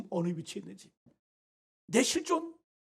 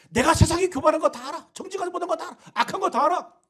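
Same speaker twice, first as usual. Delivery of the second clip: higher, louder declaring "다 알아"; God's loud reply; 7 semitones higher, 7 dB louder than usual. Retold or imitated. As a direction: call out "다 알아" high and loud for God. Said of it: "그러나 그게 뭐 어쨌다는 거야?".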